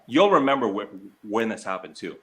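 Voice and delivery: usual speaking voice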